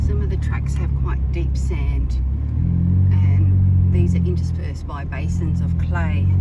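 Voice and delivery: measured documentary read; voiceover narration. Acted. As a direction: read it like a travel show narrator.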